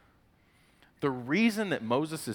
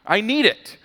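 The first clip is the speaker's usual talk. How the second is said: very high, loud mock tantrum; childish demanding; 12 dB louder than usual. Us sitting there begging God like children, hammed-up shrieky demand. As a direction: wail it like a toddler demanding a toy. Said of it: "we're sitting there going".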